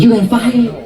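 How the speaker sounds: imitated Chinese accent. Nail lady repeating herself to client, imitated Chinese accent. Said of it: "That's her accent".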